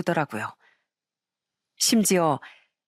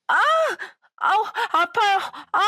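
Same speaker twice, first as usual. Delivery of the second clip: yelling in pain; 11 semitones higher than usual. Acted. Sister-in-law thrown down in the schoolyard, howling in pain. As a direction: howl it like you've just been thrown to the ground. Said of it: "고래고래 소리를 치면서 아프다고".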